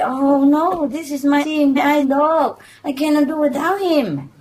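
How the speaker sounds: high-pitched, insistent plea; mock protest. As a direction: protest with put-on earnestness. Dog owner acts protest to doorman.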